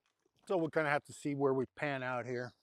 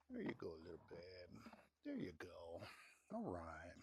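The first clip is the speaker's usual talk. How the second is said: hushed, tender coo to a calf; soft cooing; 8 semitones lower, 15 dB quieter than usual. Real, not acted. Rancher coaxing a calf, soft tender coo. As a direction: murmur gently to coax the calf closer.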